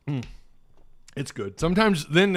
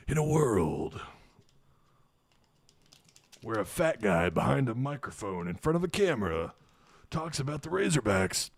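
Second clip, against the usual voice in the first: in a world, trailer style; movie trailer voice; 2 semitones lower, 6 dB quieter than usual. Pretend.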